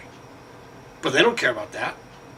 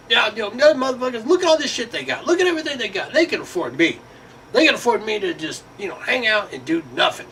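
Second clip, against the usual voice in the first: high, hammed-up dismissive voice; mock careless coworker; 8 semitones higher than usual. Coworker shrugging off company losses, high mocking voice.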